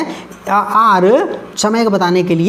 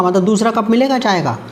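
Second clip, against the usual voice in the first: usual speaking voice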